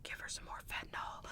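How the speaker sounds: hushed whispered order; a soft, voiceless whisper. Retold or imitated. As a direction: whisper back the instruction. Doctor whispering reply.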